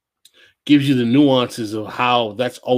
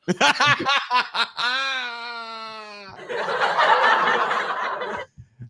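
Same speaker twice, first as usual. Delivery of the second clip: high shrieking laugh; 12 semitones higher, 4 dB quieter than usual. Real, not acted. Laughing at the DJ imitation.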